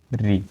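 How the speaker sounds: री said briefly; short vowel. Shown as a demonstration. Teacher lists short vowels, short री. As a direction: keep री to one beat. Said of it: "यानी कम समय लगे".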